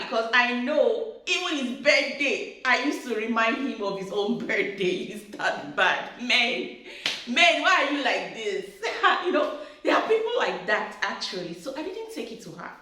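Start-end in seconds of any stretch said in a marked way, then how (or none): none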